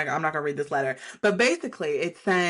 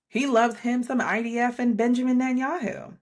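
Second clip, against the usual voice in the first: ordinary talk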